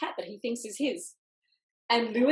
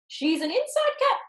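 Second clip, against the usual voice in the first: high, lightly voiced cat friend; high Socks voice; 13 semitones higher, 4 dB louder than usual. A narrator's reading. Socks the cat advising Lewis over cream, in a high voice. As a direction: pitch it up as the prim cat Socks. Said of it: "said Socks".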